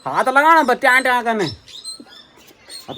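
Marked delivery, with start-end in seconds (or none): 0.1-1.4 put-on high, lisping baby talk to a little girl. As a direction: coo at the toddler in a high lisp. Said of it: "वो तोतले वाली style की ना".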